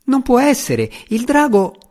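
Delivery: blurted sprite. A narrator's reading. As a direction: let it slip out in a squeak. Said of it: "Tefanis sbottò".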